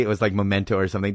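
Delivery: normal talking voice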